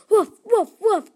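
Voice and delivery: loud mock woof woof; dog barking. Storyteller imitates the dog, loud barking. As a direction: bark like a big dog. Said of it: "the dog started barking".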